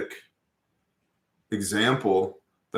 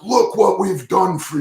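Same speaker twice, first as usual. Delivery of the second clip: slow, loud, higher-pitched furious declaration; angry Moshe; 9 semitones higher, 6 dB louder than usual. Retold or imitated. Moshe striking the rock and rebuking the people, slow and furious. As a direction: spit it out at the ungrateful crowd, slow and loud. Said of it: "he was pissed".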